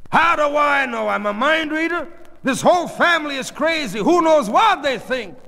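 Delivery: high, loud ranting; exasperated yelling. Acted. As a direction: rant back at the whole family. Father yells back, exasperated.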